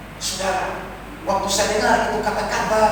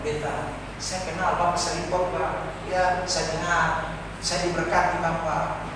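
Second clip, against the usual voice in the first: much softer, weak voice of a dying woman; faint fading voice; 4 semitones lower, 10 dB quieter than usual. Retold or imitated. Dying cancer patient telling the pastor she knows him, faint fading voice. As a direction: let the voice almost vanish. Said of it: "suaranya hilang-hilang".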